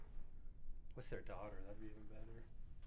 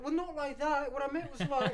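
mock-flustered, much higher retold celebrity; hammed-up backpedaling, pitched way up; 11 semitones higher, 4 dB louder than usual. Retold Osbourne daughter backpedaling.

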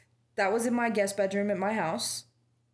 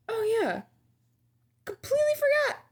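mock clueless reply; 13 semitones higher than usual. Acted reply from coworker.

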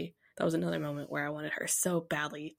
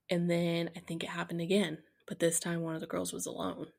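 ordinary delivery

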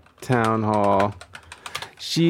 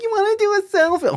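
much higher, fast, sugary mock politician; faster than usual. Streamer imitates the politician offering a heckler a selfie, much higher and fast. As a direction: squeak it like a fake-friendly politician. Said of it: "she's like".

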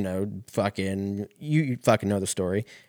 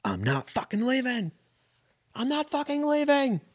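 much higher, rising into a yelled movie-speech defiance; Wolf of Wall Street speech. Retold or imitated. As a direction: belt it out like the film's rallying speech. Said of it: "that speech scene from Wolf of Wall Street".

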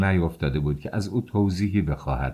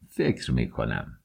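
low, slow, subdued reply; answer after silence; 9 semitones lower, 5 dB quieter than usual. A narrator's reading. Lodger answering the maid after a silence, reluctantly. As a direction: let it out slowly and softly, pitched down. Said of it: "پس از سکوتی جواب داد".

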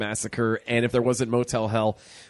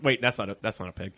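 normal talking voice

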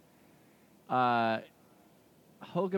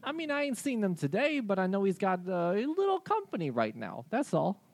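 higher, friendly reindeer voice; 8 semitones higher, 5 dB louder than usual. Acted. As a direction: play the chatty reindeer.

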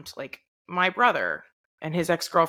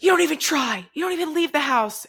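higher-pitched, retold fatherly outburst; upset yelling dad; 10 semitones higher, 3 dB louder than usual. Dad scolding grown child about being single, mock yelling. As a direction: imitate a frustrated dad raising his voice. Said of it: "he was, like, yelling at me".

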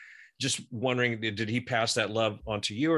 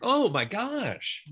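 amazed; 11 semitones higher, much slower than usual. Real, not acted. Amazed reaction to late start.

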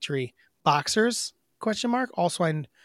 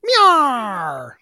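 loud whiny meow; a crying cat meow; 6 semitones higher, 8 dB louder than usual. Pretend. His own meow takes.

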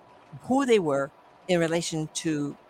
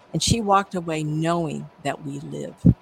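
ordinary talk

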